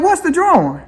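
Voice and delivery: high, puzzled quoted question; curious question. Retold or imitated. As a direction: ask like you just noticed it. Asking about the drawing.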